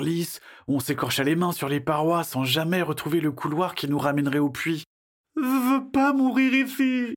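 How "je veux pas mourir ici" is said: put-on very high, drawn-out child's wail; panicked wail. Boy lost underground with friends, high panicked wail.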